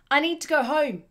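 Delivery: shout. Acted. Man telling them he must leave, desperate shout. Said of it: "he shouted to them".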